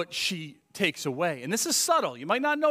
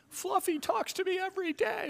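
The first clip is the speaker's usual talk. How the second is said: mock pet-owner voice; 14 semitones higher than usual. Joking about pet talking.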